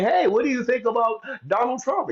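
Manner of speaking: lightly voiced pushy neighbor; nosy neighbor. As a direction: fish for a fight with fake friendliness. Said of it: "engages you and say".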